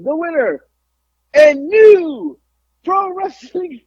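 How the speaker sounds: high, drawn-out announcer call; ring announcer. Retold or imitated. Announcing the new champion to the crowd, high ring-announcer call.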